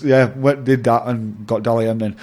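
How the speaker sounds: ordinary talk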